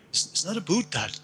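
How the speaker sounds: mock Canadian with exaggerated vowels; Canadian accent. Shown as a demonstration. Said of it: "that's how they speak in Canada".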